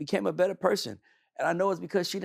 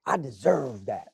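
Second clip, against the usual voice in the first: mock entitlement; 6 semitones lower, slower than usual. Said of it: "Who the fuck said you deserve".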